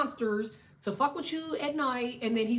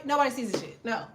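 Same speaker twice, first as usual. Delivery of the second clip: ordinary talk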